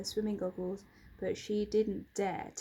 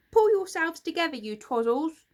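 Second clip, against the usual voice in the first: loud, fast and higher, furious creature; angry hiss; 6 semitones higher, 9 dB louder than usual. Acted. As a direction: snap at the others to get a grip. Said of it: "hissed Grunt".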